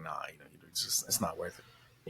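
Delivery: low, soft, trailing into slowness; retold dismissal. Him to himself about fighting, quietly dismissive. Retold decision to walk away.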